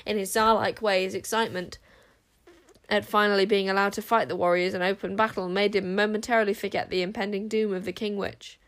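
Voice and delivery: ordinary delivery, nothing marked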